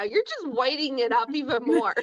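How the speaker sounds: normal talking voice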